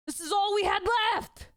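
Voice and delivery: a cornered man shouting his excuse; desperate shout. Acted. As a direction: throw the last line out in despair. Cornered man's desperate outburst.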